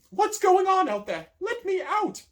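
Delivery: very high, loud, slow shout; yelling. A narrator's reading. Marian yelling from her locked cell, very high and loud. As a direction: bang and yell through the door. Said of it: "pounding on the door and yelling".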